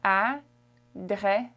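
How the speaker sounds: "Adresse" split into French syllables. Shown as a demonstration. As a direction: model "Adresse" in French with pauses between syllables. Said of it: "Address in French is".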